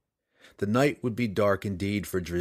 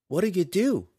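put-on higher girl's voice, worried question; Canterbury asking; 9 semitones higher than usual. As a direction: ask anxiously in the girl's voice. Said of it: "Canterbury asked".

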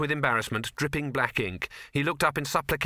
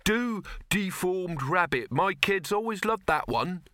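higher, slow demanding woman; 7 semitones higher, slower than usual. A narrator's reading. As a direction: insist on the old favourite. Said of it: "a second woman".

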